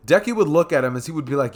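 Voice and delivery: ordinary delivery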